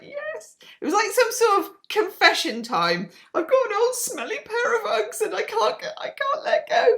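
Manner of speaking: squeaky high confession voice; a squeaky, whiny confessing fan; high silly voice. Retold or imitated. Viewers' confession in funny voice.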